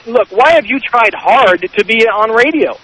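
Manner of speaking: normal talking voice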